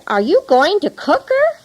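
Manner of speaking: high, rising, alarmed question; mildly frightened. A narrator's reading. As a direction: ask it as a worried mother, without screaming. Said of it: "a terrifying scream from the frightened mother".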